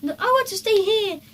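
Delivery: put-on high, fast goody-goody boy; prim little boy digging in; Peter insisting. A narrator's reading. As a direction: younger brother insisting on staying here. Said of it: "said Peter".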